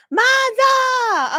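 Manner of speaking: high, loud delighted call; excited shout of a name. Real, not acted. Streamer spotting a friend in chat, thrilled call.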